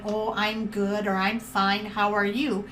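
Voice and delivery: low, subdued stock reply; unenthusiastic answer. Shown as a demonstration. Someone answering how they are, demonstrating an unenthusiastic reply. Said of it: "don't answer by saying".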